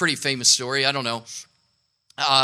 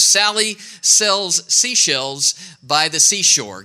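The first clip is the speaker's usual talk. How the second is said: slow, deliberate tongue twister; leading the room in a tongue twister, slowly; slow demo; slower than usual. Shown as a demonstration. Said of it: "Go ahead, five times fast".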